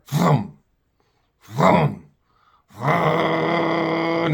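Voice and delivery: mouthed sputtering chainsaw start-up, last one held; chainsaw imitation. Dad starts the saw up the tree, mouthed chainsaw revs. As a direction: imitate a chainsaw catching on the third pull. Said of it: "the chainsaw start".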